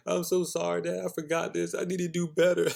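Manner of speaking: put-on high, pleading little-girl voice; tearful daughter. Daughter apologizing to dad, high and pleading. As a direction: plead like a sorry kid.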